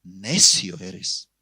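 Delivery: slow, low verdict; stern, damning judgement; merciless rebuke. Retold or imitated. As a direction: drop low and drag out the condemnation. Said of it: "Le exhortó el pastor sin misericordia".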